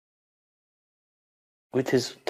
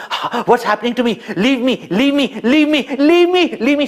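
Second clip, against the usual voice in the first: frantic high cries of leave me, climbing higher; panicked pleading, rising to a scream; 9 semitones higher, 4 dB louder than usual. Acted. Demoness screams at the baby clinging to her, panicked. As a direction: beg to be released, louder each time. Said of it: "she started feeling".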